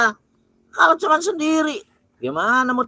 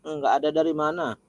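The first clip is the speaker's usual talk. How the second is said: low possessed voice; 9 semitones lower, 9 dB quieter than usual. Acted.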